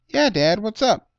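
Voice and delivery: put-on high, loud eager child; a curious kid asking dad. A narrator's reading. Child asks dad, in a high eager kid voice.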